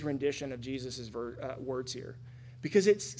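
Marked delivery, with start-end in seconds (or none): none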